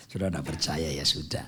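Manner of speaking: chuckling low and soft; laughing. Real, not acted. Pastor laughing off the congregation's doubt, chuckling. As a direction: laugh it off good-naturedly.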